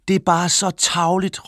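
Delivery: high-pitched, indignant mild shout; children calling out. A narrator's reading. Children tell the father off for being mean, calling out. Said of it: "råbte børnene".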